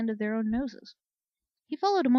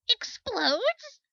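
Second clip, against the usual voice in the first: much higher, sharp rising "Explodes?"; shocked question; 14 semitones higher, 5 dB louder than usual. Acted. Startled soldier hearing the sun will explode, shocked rise. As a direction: jump up in alarm on "Explodes?".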